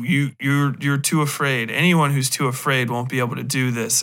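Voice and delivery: ordinary talk, nothing marked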